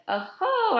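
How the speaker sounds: high, bright amused oh-ho; a delighted laughing oh-ho; woman teasing the hungry boy, amused. A narrator's reading. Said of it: "smiled real big".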